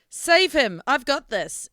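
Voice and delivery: character's battle yell. Acted. Umlaut to the party as she attacks, urgent yell. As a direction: yell it out to take charge of the fight. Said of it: "so Umlaut yells".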